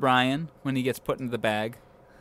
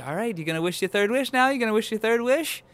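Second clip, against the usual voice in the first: fast, higher, rising nagging questions; impatient pestering; 9 semitones higher, faster than usual. Retold or imitated. Host voices Brian badgering about the third wish, pestering. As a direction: badger him eagerly, pitch climbing.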